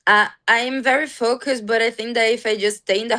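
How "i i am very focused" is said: normal talking voice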